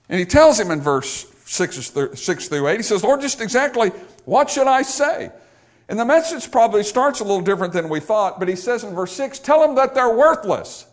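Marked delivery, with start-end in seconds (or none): 3.0-10.9 high, raised pulpit voice; impassioned preaching. Acted. Preacher voicing prophet and God to the congregation, impassioned preaching. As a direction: drive the quoted words out forcefully.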